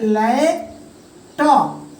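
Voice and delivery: लैपटॉप slowly broken as लैप, then टॉप; split into syllables. Shown as a demonstration. Teacher writing out a two-syllable word, लैपटॉप split in two. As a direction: say लैपटॉप in two separate pieces with a pause between. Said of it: "word को दो टुकड़ों में बटा है".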